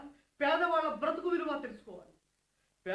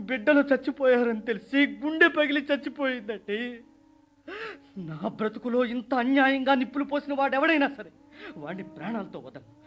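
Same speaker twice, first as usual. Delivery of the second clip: louder, raging outcry; furious grief; 4 dB louder than usual. Acted. Widower swears revenge on the killer, louder and raging. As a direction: hurl it out in anguished fury.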